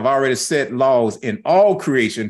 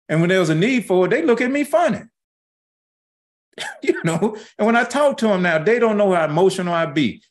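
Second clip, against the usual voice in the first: higher, climbing pastor's preach; rising preaching voice; 7 semitones higher, faster than usual. Acted. Pastor stirring the congregation, higher preaching voice. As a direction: lift it up like the pulpit.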